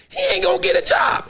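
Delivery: 1 mock high voice. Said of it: "I was like".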